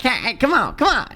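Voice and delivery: high mock taunt; goofy fighting Godzilla. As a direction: egg on a rival like a goofy fighting monster. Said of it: "you know, like".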